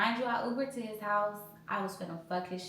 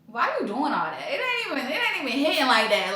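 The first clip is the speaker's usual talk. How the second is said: high, loud teasing whine; loud mock gripe at him; mock whiny complaint; 5 semitones higher, 9 dB louder than usual. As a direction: laugh through a mocking complaint. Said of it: "I'm like".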